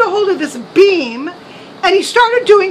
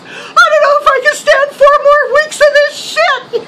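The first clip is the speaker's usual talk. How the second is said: high, loud, laughing rant; exasperated outburst; 9 semitones higher, 4 dB louder than usual. Retold or imitated. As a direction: blow up in mock despair. Son exasperated over phone.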